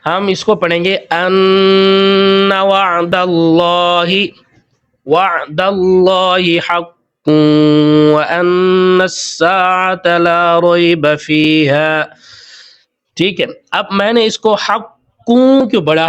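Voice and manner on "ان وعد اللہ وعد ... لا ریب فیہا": ان nasalised and held, very slow chant; slow nasal ghunnah demo; recitation with ghunnah. Shown as a demonstration. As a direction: hum the ghunnah on ان, then recite slowly. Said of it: "ہم اس کے اوپر غنہ کریں گے".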